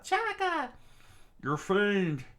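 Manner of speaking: very high, loud screaming of his name. Acted. Phoebe screaming at Chaka as he charges the gates. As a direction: shriek it in alarm. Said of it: "Phoebe screamed out".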